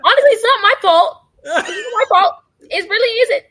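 high, loud mock-defensive plea; playful protest. Real, not acted. Playfully protesting after being teased.

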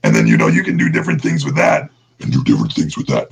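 deep monster growl; Beast voice. Shown as a demonstration. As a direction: keep talking in a low beast growl. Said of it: "I could do the Beast voice".

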